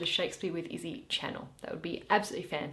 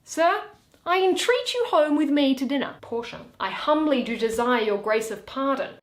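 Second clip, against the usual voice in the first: Shakespeare read aloud, every part voiced in turn; theatrical reading of a courtroom scene; dramatic play reading; 6 semitones higher, 6 dB louder than usual. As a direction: read the scene like a stage performance. Reads all parts of the play aloud.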